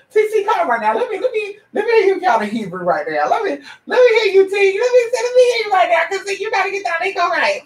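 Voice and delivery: very high, playful voice to the pet; squeaky coaxing. Real, not acted.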